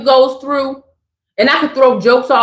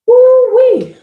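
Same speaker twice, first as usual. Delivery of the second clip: a big whooping woo-wee, higher and louder; 9 semitones higher, 4 dB louder than usual. Real, not acted. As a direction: let it burst out like steam. Excited exclamation after rant.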